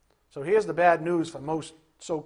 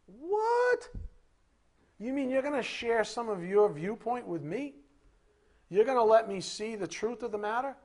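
very high astonished What, higher throughout; amazed; 4 semitones higher than usual. Acted. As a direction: gasp in disbelief at the gift. Mock amazed believer.

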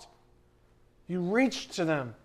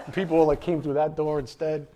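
ordinary delivery

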